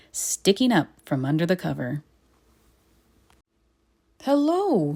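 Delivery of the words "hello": high, surprised hello; friendly greeting. Acted. Watchman finding the bear under the cover, high and friendly. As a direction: greet him kindly. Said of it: "he said".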